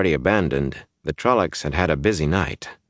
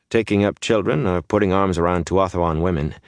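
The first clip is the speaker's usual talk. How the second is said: usual speaking voice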